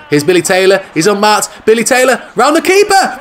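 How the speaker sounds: excited commentator reaching a shout; rising excitement. Real, not acted. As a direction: call the chance as it builds. Live goal chance commentary.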